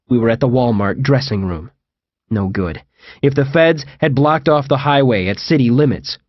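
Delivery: ordinary delivery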